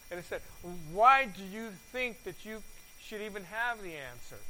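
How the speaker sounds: loud, deliberate reading of the Bible passage; scripture reading. Acted. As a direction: proclaim the verses to the congregation. Reads Second Kings chapter five.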